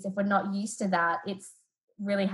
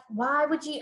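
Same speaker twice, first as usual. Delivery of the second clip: higher-pitched exasperated outburst, retold; mild mock scolding at a higher pitch; reactive parent; 7 semitones higher, slower than usual. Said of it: "And I reacted".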